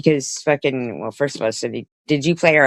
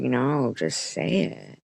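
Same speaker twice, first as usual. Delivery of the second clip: imitated meek reply; 7 dB quieter, slower than usual. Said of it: "He was like".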